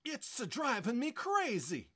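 loud, high-pitched lament; wailing. Acted. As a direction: wail like it's unbearable. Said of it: "he wailed".

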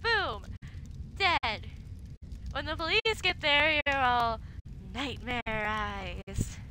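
ordinary talk

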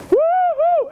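high-pitched "woo-hoo"; party whoop. Real, not acted. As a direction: whoop high to cap the song. Cheering after singing.

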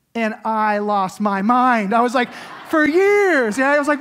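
loud, high, thrilled storytelling; excited retelling. Retold or imitated. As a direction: relive the joy at full volume. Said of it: "absolutely so excited".

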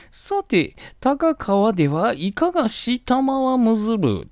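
mother breaking the gloom with a playful question; joking mother. Retold or imitated. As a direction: deliver the quip in a lofty noblewoman's tone. Mother's joke voiced in lesson.

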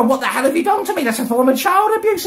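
fast high furious demand; angry. Retold or imitated. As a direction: fire it at her. Confronting mum.

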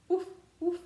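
high, bright-sounding yappy puppy woof; puppy bark. Acted. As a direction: bark like a little playful puppy. Dog barks in picture book.

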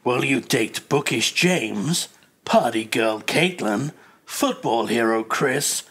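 slow, low trailer narration; trailer voiceover. Acted. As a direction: read it like a teasing game trailer, slowly. Reads out game's romance trailer.

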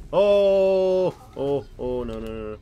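high, loud screaming no's; terrified scream. Real, not acted. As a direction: scream in panic. Monster appears again.

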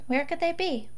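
ordinary delivery

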